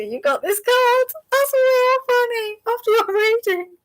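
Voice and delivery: high, giggling through the words; can't stop laughing at the coincidence. Real, not acted. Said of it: "I just think it's really funny".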